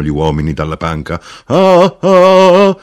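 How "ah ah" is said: high, loud drawn-out "aah"; loud "ah" cries joining the revelry; whooped, sustained "ah, ah"; two drinkers' "ah, ah". A narrator's reading. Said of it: "dissero i due seduti vicino al braciere".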